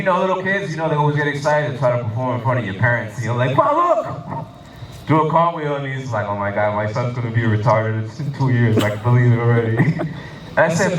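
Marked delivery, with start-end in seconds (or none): 3.5-4.5 put-on much higher, eager child's call; small boy calling his dad to watch a cartwheel; excited little kid. 6.3-9.8 lower, groaning father voice; a father groaning at his son's cartwheel; dismayed dad. Retold or imitated.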